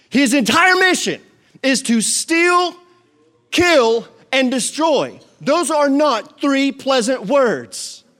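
loud, high, slow and hammered home; full-force preaching. Acted. Preacher warning his church about the enemy, in full-force preaching. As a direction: preach each word like a warning.

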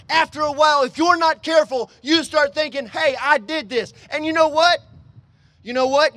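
loud, high-pitched pulpit exhortation; full-force preaching. Acted. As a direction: drive each phrase out to the congregation.

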